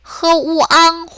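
흐우앙 split into 흐, 우, 앙; level, separated syllables in 흐우앙; sounded out separately. Shown as a demonstration. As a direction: break 흐우앙 apart for learners. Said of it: "우리 이것도 분리해서".

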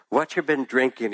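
lightly voiced old man, curious. A narrator's reading. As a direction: ask with twinkling interest. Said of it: "his eyes sparkling with interest".

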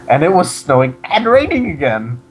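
swooping mock-dramatic read; hammed-up reading. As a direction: overplay the weather line. Dramatic reading of fan fiction.

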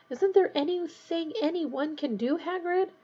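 high, intense but held-in question; understated fierce urgency, pitched high; fierce plea. A narrator's reading. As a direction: plead urgently without raising the volume. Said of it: "Harry asked fiercely".

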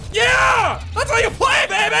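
high-pitched victory shout; exultant, high yell; triumphant crowing. Real, not acted. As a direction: crow over your win. Crowing over a kill.

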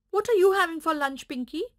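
hungry puppy asking the donkey; eager puppy voice. Acted. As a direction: rattle it off like an eager pup. Puppy asks pig.

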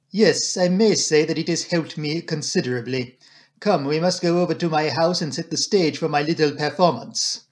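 put-on French-accented detective, pleased and decisive; courteous Belgian detective voice; brisk, approving Poirot. Detective summoning the doctor to his house, decisive.

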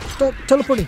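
high, loud complaint; exasperated gamer shout; frustrated yell. Real, not acted. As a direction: yell it at the enemy. Frustrated at teleporting enemy.

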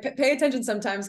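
ordinary talk

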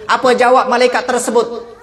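ordinary delivery

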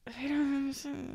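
a whispered, playfully baffled aside; mock whisper. Host jokingly whispers back at cohost's question, mock whisper. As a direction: breathe it out under your breath in fake confusion.

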